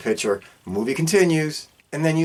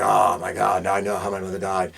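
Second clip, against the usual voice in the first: much lower, hammed-up movie hero line; mock hero realization; 6 semitones lower than usual. Son finally learns how his mother died, hammed-up and low. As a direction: spoof the son's big realization in a low voice. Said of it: "He's like".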